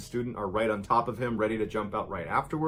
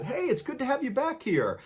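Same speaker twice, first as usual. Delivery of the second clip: calling out; 14 semitones higher than usual. A narrator's reading. Students greeting a newcomer across the lawn, calling out. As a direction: call out a greeting in a raised, high voice. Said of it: "a couple people yelled out to him".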